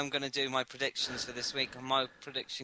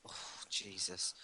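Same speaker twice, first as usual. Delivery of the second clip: whispered exasperation; 7 semitones lower, 14 dB quieter than usual. Real, not acted. Reacting to rival prediction.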